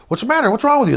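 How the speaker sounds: high, loud worried calling out; alarmed shout. Retold or imitated. Calling out to fleeing friends.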